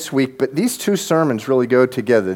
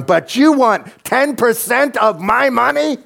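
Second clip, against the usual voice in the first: pitched way up in mock disbelief; incredulous; 12 semitones higher, 4 dB louder than usual. Young believer balking at the tithe, incredulous and high. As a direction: play your stunned young self hearing the ask. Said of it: "I was like".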